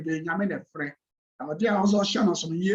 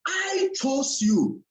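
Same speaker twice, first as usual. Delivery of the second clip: "I" stretched long and high, then falling; stress on I; 5 semitones higher, much slower than usual. Shown as a demonstration. Preacher quoting Jesus to the congregation, stress on "I". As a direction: hold a high "I" before "chose you". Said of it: "Emphasis on here".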